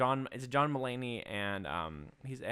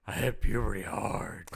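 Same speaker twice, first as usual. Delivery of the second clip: mock deep voice; 2 semitones lower, slower than usual. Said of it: "That's pretty good".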